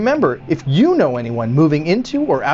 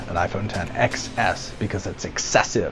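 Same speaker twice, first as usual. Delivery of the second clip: low muttered aside; 8 semitones lower, 8 dB quieter than usual. Acted. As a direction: lower and softer, under the breath.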